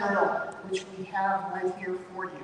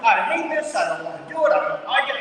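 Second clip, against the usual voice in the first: louder mock complaint, pitch raised on "I hate this"; imitating an angry man; 4 semitones higher, 6 dB louder than usual. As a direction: ham up the grumbling churchgoer. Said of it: "just so angry at me".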